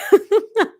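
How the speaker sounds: a short laugh at her own joke; laughing at her own cheeky line; high burst of laughter. Real, not acted.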